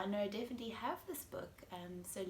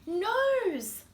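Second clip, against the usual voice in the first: put-on lively storybook voice for kids; reading to children; 5 semitones higher, 11 dB louder than usual. A narrator's reading. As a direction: read it like bedtime story time. Reads picture book to children.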